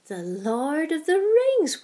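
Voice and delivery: put-on high, slow, thrilled old woman's exclamation; light up at the title; old lady delighted to see the book. A narrator's reading.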